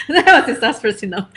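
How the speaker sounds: giggling through the words at the memory; laughing. Real, not acted. Author laughing to her online audience about singing a chapter.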